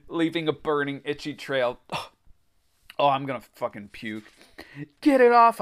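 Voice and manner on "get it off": high, loud pleading moan; desperate moan. A narrator's reading. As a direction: beg in distress. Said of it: "I moaned".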